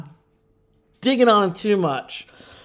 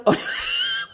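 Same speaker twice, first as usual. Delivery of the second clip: high laugh. Real, not acted. Laughing at Tim's remark.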